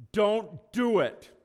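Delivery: sharp, high, loud, slow "don't"; stern nurse's warning. Retold or imitated. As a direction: warn him off like a strict nurse. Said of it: "the type nurse that just looks at you and says".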